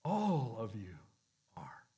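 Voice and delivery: gentle inner voice. Retold or imitated. Spirit of God speaking to the crowd, fading softly. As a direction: let it sink like a whisper in the conscience. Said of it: "the Spirit of God was saying".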